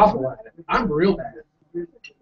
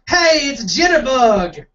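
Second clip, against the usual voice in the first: higher, drawn-out mock call; 7 semitones higher, slower than usual. Inmate hailing the new guy from the back, calling out. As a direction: call it out slowly across the room. Inmate calls out across the room.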